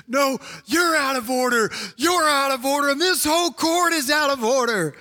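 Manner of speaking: high, defiant courtroom outburst; Al Pacino yelling. Acted. Attorney turning on judge and court, yelling. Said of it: "Al Pacino stands up and he yells".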